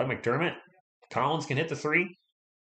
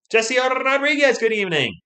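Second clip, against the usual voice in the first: excited greeting; 13 semitones higher, 8 dB louder than usual. Real, not acted. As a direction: sing out the viewer's name. Greeting a chat viewer.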